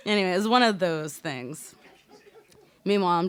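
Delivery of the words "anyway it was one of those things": usual speaking voice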